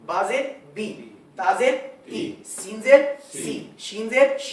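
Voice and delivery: بی, تی, سی with a pure long ee, slowly; careful slow teacher demo of بی تی سی شی; slow correct ee vowels. Shown as a demonstration. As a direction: spell them out slowly with a clear ee. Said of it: "یہ غلط ہے".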